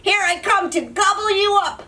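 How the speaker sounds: high bellowing troll. Acted. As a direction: bellow it at the goat.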